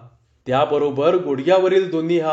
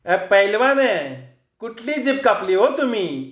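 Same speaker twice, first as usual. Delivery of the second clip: a lightly voiced villager heckling from the crowd, higher; calling out from the crowd; 4 semitones higher than usual. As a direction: call the question across the crowd, teasing. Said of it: "गर्दीतून एक जण ओरडला".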